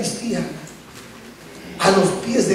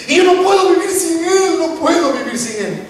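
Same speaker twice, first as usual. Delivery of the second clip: high cry sinking to usual pitch; desperate lament; 7 semitones higher than usual. Acted. Mary saying she cannot live without him, desperate. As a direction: start high in acted anguish, then sink.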